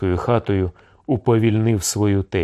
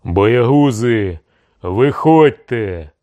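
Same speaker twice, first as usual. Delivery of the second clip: loud, slow, drawn-out taunting shout; 3 semitones higher, 5 dB louder, much slower than usual. A narrator's reading. Cossack chief orders cowards out of the house, shouting. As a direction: shout them out, stretching each word. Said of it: "закричав курінний".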